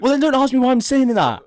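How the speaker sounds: high, loud, exasperated snap back; exasperated retort. Real, not acted. Telling his friend off for asking why, exasperated and loud. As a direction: push back at the friend, fed up.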